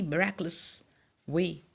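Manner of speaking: usual speaking voice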